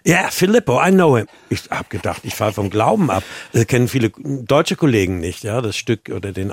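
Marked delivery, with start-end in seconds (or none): 0.1-1.3 higher and louder, eager recognition; enthusiastic reply. Retold or imitated. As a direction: toss it off with eager delight. Regisseur antwortet begeistert auf Englisch. (begeistert nachgeahmte Antwort, höher und lauter)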